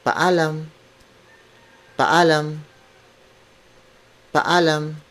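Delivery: slow teaching drill of "paalam"; careful repetition. Shown as a demonstration. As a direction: model "paalam" with both a's sounded.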